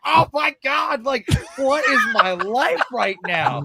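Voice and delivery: high, drawn-out cry of disbelief; starstruck amazement. Retold or imitated. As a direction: wail it slowly, overwhelmed with joy. Acting out his starstruck amazement.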